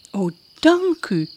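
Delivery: put-on young girl voice, rising high at the end; hopeful girl pleading; eager princess. Princess asks the witch for help, eager and rising high.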